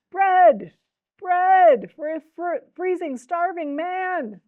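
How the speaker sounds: high, loud, long-drawn street cry; beggar's cry. A narrator's reading. Starving beggar crying below the castle window, drawn-out shout. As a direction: draw out the plea slowly and loudly, pitched high. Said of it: "the beggar cried".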